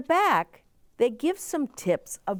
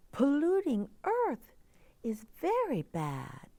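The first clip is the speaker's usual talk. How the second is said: put-on higher, soft, wondering girl's voice; higher, hushed girl asking the moon; young girl character; 6 semitones higher, 4 dB quieter, slower than usual. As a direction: play the worried little girl asking for help. Child character Sophia speaks.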